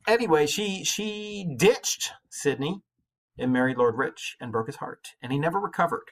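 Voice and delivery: ordinary talk, nothing marked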